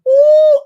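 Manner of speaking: loud high crying "ooh"; hammed-up sob bursting out; mock wail. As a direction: wail like a movie softy. Mimics crying at The Lion King.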